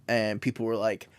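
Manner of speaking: ordinary talk, nothing marked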